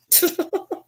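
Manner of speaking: short high giggle; laugh. Real, not acted. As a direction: break into a squeaky giggle. Laughs at the dog's answer.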